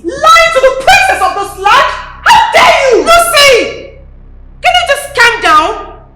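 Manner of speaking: loud, high, tearful fury; enraged yell. Acted. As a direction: let the rage break through. Princess rages, sister shouts back.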